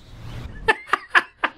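short breathy laugh. Real, not acted. Streamer losing it mid-reading, short laugh. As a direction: break into a brief laugh.

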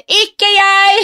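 put-on high child's quick denial; child shouting. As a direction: shout it like a guilty little girl. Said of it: "roper Jenny".

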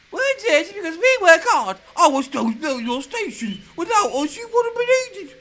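indignant trucks. Acted. Trucks argue back at Clarabel, high and loud. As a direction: push back hard with mocking laughter.

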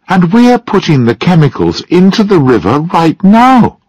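high, pleading protest; urgent. A narrator's reading. Mary urging John to stop making the paint now, urgent. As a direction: press the point, pitch rising high.

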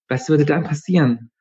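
a hint of fear in a young voice; worried girl. A narrator's reading. Mitra asks.

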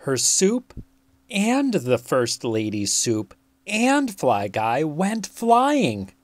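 plain reading voice

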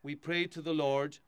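steady, solemn prayer reading; hushed graveside prayer. Acted. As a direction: lead the mourners in prayer. Priest leads graveside burial rite.

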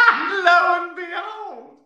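high, loud, slow hammed-up announcement; mock grand reveal. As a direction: belt it out like a grand unveiling. Big reveal in the story.

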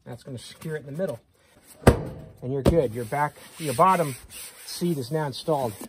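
ordinary delivery

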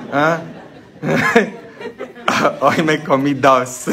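ordinary talk

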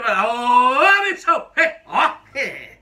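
loud, drawn-out call to a workmate, with a smile; friendly shop-floor greeting. Shown as a demonstration. Worker demonstrating the friendly hello, called out loud with a smile. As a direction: hail a buddy across the line, grinning. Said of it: "Se tiver um sorrisinho no final".

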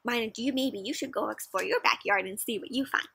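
ordinary delivery

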